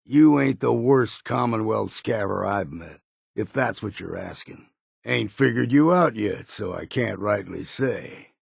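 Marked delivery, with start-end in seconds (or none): none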